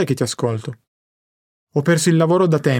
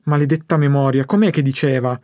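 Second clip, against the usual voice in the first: ordinary delivery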